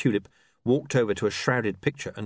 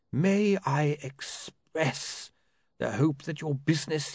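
slow, higher dry lawyer; 7 semitones higher, slower than usual. A narrator's reading. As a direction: weigh every word, lawyer-like.

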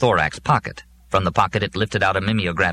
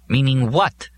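sharp demand; 6 semitones higher, slower than usual. Acted. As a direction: snap the question at him. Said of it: "Molly demanded sharply".

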